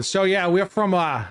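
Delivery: lightly put-on New York accent; playful fake Boston accent; mock New Yorker accent. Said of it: "he's trying to do a New Yorker accent".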